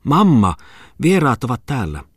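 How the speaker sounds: high eager shout to her mother; girl announcing the guests, calling out loud; excited call. Acted. Daughter calls out to mamma, loud and eager. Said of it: "ja huudahti".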